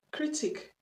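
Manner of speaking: British pronunciation of "Critique"; "Critique" in a British accent. Shown as a demonstration. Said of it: "c'est l'accent british".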